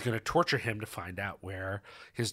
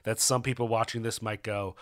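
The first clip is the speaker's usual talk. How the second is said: ordinary talk, nothing marked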